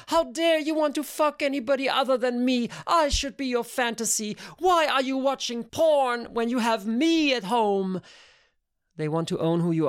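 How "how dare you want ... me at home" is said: high, indignant mock tirade; hammed-up jealous rant; jealous outrage, mimicked. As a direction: imitate a possessive lover's scolding, pitched way up. Mimics possessive jealous women.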